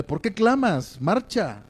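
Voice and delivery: acted impatience without raising the volume; mild reproach. God coming at Moses with that attitude, mildly reproachful. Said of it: "venir con una actitud así".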